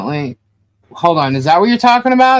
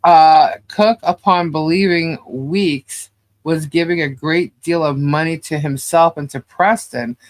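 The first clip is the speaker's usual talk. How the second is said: normal talking voice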